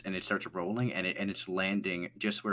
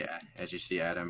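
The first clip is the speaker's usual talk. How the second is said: normal talking voice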